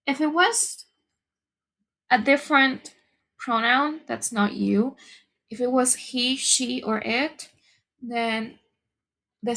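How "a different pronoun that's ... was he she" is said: normal talking voice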